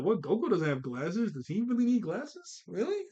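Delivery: rising, incredulous disbelief; surprised. Retold or imitated. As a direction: ask it in pure disbelief. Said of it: "I was like".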